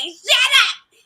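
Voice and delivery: high, loud retort; hammed-up outburst; mock shout. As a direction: imitate someone yelling back, voice shooting way up. Acts out friend's retort.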